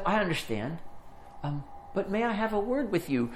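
soft, high, patient request; gentle calming. Retold or imitated. Older woman interrupts the rapper's rant, gently insistent. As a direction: soothe him without competing in volume. Angelou calms an angry rapper.

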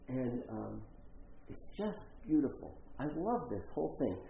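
normal talking voice

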